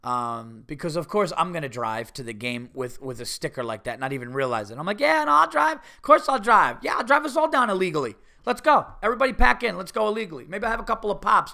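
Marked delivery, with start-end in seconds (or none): 5.0-11.5 high, loud self-parody of reckless eagerness; rally everyone cheerfully, as if nothing could go wrong; loud, cocky, higher-pitched send-up; mock bravado. Mocking himself as carelessly eager driver.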